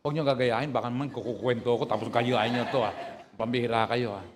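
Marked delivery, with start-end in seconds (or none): none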